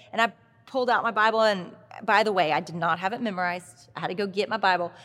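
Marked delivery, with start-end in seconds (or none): none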